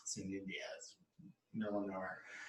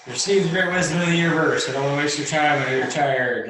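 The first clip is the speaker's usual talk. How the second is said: brisk guru; 4 semitones higher, 10 dB louder than usual. Acted. Guru hurrying the tired visitor.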